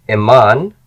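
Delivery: loud, clear model for students; repeat-after-me model. Shown as a demonstration. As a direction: project the word for the class to echo. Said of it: "just repeat after me".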